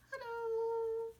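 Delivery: very high, drawn-out squeaky hello; puppet bear voice. Pretend. Bear greets teacher, in puppet voice. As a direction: pretend to be a little bear.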